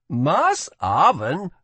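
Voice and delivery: as if she must be joking; amazed. A narrator's reading. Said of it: "let out an amazed laugh".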